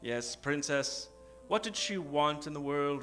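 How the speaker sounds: usual speaking voice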